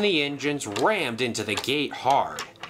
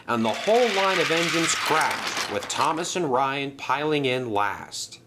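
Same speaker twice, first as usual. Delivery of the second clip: ordinary delivery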